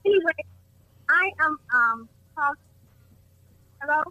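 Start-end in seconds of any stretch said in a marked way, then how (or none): 3.8-4.0 caller heard through a speakerphone; distant speakerphone sound on the line. Real, not acted. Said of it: "Are you on speakerphone?".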